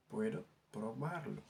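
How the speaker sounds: slow model for the class to repeat; slow careful repeat. Shown as a demonstration. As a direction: say Puedo probarlo slowly, stressing probarlo. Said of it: "teacher repeats the phrase slower". (waxaat ko ndànk ndànk)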